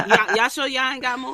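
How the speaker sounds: teasing through laughter; laughing tease. Real, not acted. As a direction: giggle the challenge.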